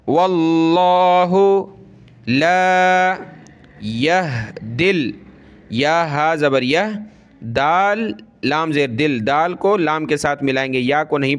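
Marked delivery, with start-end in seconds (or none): 0.1-5.1 Quran recitation. Shown as a demonstration. Teacher recites the verse slowly for pupils. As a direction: intone it slowly like tilawat.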